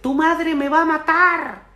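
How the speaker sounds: high, slow panicked yell. Retold or imitated. Father yelling in panic over his fallen son. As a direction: wail it out in mock panic. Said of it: "Lo primero que le grito es".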